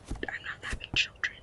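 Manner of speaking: very soft, voiceless whisper; whispered aside. Real, not acted. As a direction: whisper it to yourself. Aside after seeing kid's item.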